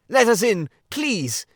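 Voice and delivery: high, loud begging through the door; pleading call. Acted. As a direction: beg to be let in, loudly. Rat begs at the door.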